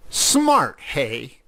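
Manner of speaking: high, loud first word, then dropping; angry sarcasm. A narrator's reading. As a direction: snap sarcastically at the culprits. Angry cat confronts teasing mice.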